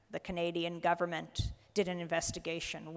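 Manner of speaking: ordinary talk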